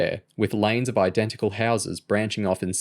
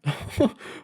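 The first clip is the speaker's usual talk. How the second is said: airless, unvoiced giggle; breathy laugh; 14 semitones higher than usual. A narrator's reading. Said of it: "James started laughing".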